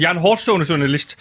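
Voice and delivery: usual speaking voice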